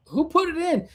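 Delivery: very high-pitched mock demand; acted-out astonished question; incredulous. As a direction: ask it in squeaky disbelief.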